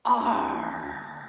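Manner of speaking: sharp gasp in, then a loud breathy pirate "Arr"; big exhale growled out as a pirate; pirate sigh. Shown as a demonstration. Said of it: "saying your special pirate sigh".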